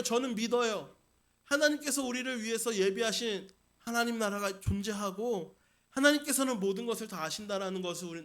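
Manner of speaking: usual speaking voice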